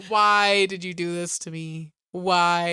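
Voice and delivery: slow, drawn-out exasperated complaint; annoyed wife. Retold or imitated. Imitating the wife after the husband's ego was fed, drawn-out annoyance. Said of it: "his wife was so mad".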